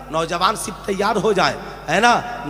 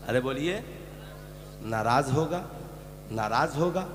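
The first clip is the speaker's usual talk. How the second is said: ordinary talk, nothing marked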